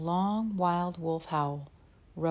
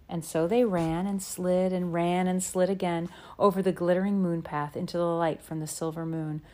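plain reading voice

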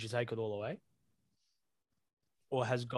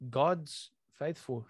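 slow, louder retold reassurance; emphatic reassurance; 3 semitones higher, 5 dB louder, much slower than usual. Mum reassuring her struggling child, slow emphatic reassurance. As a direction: stretch it out firmly, imitating a reassuring mum. Retelling mum's firm reassurance.

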